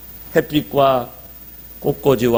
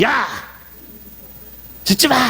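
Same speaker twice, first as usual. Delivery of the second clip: sharp, much higher shout at the dog; yelling at the dog; 10 semitones higher than usual. Retold or imitated. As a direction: bark an order to stop. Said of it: "그 개는 더 공포에 떨어서".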